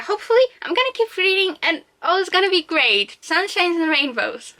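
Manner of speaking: high-pitched, put-on cheeriness; mock optimism. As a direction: pretend everything is wonderful. Mock optimism, then "yeah, right.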